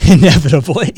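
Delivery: breaking into a laugh mid-word; amused real laughter. Real, not acted. Laughing about school trips.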